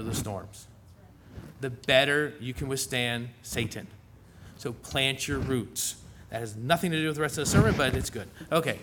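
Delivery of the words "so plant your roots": slow, deliberate, every word weighted; slow emphasis. Acted. Preacher slowly driving home his advice to the congregation. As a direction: plant your roots driven home slowly. Repeats the sermon's key advice.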